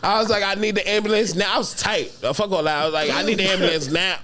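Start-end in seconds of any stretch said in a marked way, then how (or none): 0.4-1.5 high, urgent mock plea. Begging his girlfriend for an ambulance, high urgent. 3.1-4.2 high, urgent mock plea. Begging his girlfriend for an ambulance, high urgent.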